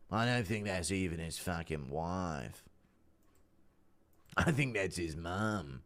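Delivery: low, soft, slow muttering; muttered aside. Real, not acted. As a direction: mumble it to yourself, slowly. Mocking aside about Ian.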